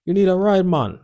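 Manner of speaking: loud, high-pitched Jamaican accent impression. Cab driver offers a stranded drunk man a ride, in a loud Jamaican accent. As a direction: call it out in a big Jamaican accent. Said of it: "He said it in an accent".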